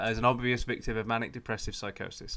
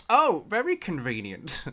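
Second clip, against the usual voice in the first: higher, slow, sarcastic drawl; 6 semitones higher, slower than usual. Retold or imitated. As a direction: drip with sarcasm, slowly and higher. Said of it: "the American press were like".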